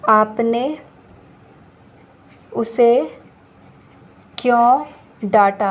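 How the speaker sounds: teacher dictating to the class word by word; slow dictation. Shown as a demonstration. Teacher reads the sentence slowly for students to write. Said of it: "अगर मैं बोलूँ".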